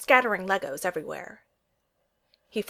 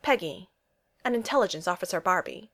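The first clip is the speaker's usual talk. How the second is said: ordinary delivery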